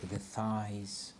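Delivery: calm, soft meditation guide voice; guided meditation. Acted. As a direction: ease them into a body scan. Leads a grounding meditation.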